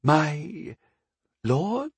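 high, halting disbelief with a rising end; taken aback. A narrator's reading. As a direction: stammer it out as an astonished abbess. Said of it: "eyes get bigger and bigger".

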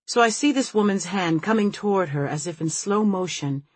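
usual speaking voice

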